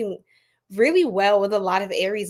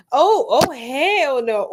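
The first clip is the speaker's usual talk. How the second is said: ordinary delivery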